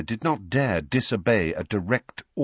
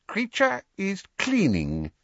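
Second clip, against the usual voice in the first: very high, slow elf voice; evasive; 14 semitones higher than usual. Acted. Elf answering George's question, evasively. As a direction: draw it out high, dodging the question. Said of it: "he said evasively".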